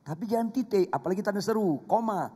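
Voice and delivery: usual speaking voice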